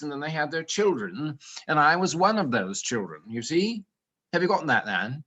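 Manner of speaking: mock British chattiness; silly British accent. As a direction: put on a silly English accent. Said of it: "you notice the silly accent I'm using".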